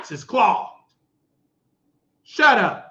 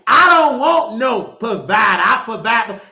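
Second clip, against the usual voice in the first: mocking her words; 6 dB louder than usual. Mocking a woman who claims she provides, mock voice. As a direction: parrot her boast back at her. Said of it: "stop telling me".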